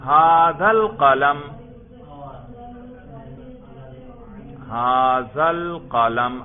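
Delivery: projected classroom dictation with long vowels; slow dictation. Shown as a demonstration. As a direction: dictate slowly, stretching each word. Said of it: "اب دوسرا جملہ لکھیں".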